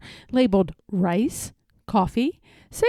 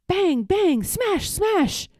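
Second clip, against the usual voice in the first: bang, bang, smash, smash called out high and lively; excited bang smash; 8 semitones higher, slower than usual. A narrator's reading. Narrator voicing Tom Thumb's smashing rage, high and excited. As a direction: ring out the blows with glee.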